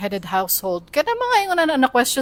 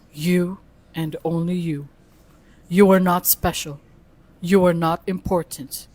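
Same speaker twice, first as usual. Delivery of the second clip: Google Gemini voice; 6 semitones lower than usual. Acted. Reading the AI's message to a human, Google Gemini voice. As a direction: speak down low as a measured machine. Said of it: "Maghimo ka Google Gemini voice".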